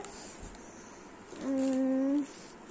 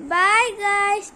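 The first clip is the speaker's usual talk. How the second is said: loud bright bye to viewers; cheerful goodbye; 3 semitones higher, 6 dB louder than usual. Real, not acted. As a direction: call out bye guys happily. Sign-off to viewers.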